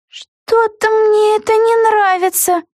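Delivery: ordinary delivery, nothing marked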